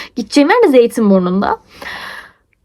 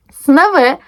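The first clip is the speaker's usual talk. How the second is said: normal talking voice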